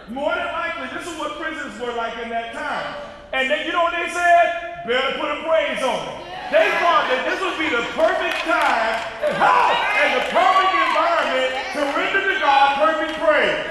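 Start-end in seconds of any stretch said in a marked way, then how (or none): 3.3-13.7 loud, high preacher's cry; preaching shout. Acted. Preacher shouting at the congregation, fired up.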